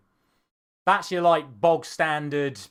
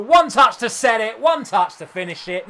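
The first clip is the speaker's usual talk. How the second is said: excited commentary; 8 semitones higher, 10 dB louder than usual. Real, not acted. Goal scored in a game.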